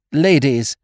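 put-on high, flustered young toff; a nervous upper-class young man; flustered young gent. A narrator's reading.

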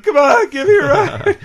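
hammed-up whiny plea; pleading Homer. Describing Homer begging for a ride, mock crying. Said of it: "sweaty large man in a dress saying".

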